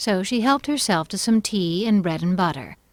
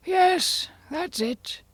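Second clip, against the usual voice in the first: high start sinking into a sigh; 13 semitones higher, much slower than usual. A narrator's reading. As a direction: agree with a sigh, voice falling away. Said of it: "said the Hatter with a sigh".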